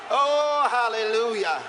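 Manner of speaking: preacher praising at the climax; exultant hallelujah. Real, not acted. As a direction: cry out to God in joy.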